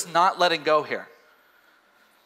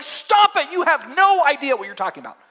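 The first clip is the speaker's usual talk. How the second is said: higher, sharp mock rebuke; exasperated retort; 7 semitones higher, 4 dB louder than usual. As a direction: snap back at the critics. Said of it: "I'm like".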